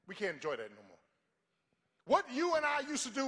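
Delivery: loud, high, fervent sermon shouting; full-force preaching. Acted. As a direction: preach it out to the whole church.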